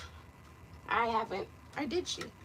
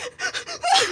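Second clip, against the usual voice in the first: crying laugh. Real, not acted. Crying while retelling.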